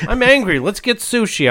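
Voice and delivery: hammed-up angry person craving sushi; mock angry eater. Mocking a moody eater.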